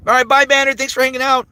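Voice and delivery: bright high-pitched farewell to a viewer; cheerful high goodbye. Real, not acted. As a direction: sing out a friendly goodbye. Saying bye to chatter.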